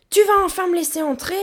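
fast, high, loud demand; cri. A narrator's reading. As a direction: shout impatiently to be let in. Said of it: "cria-t-il". (cri aigu, rapide et impatient)

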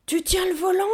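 high, loud holler; bellowed question. A narrator's reading. Gorilla pushing checks on the young driver, bellowing. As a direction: bellow it from behind the vehicle. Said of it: "Beugla la gorille".

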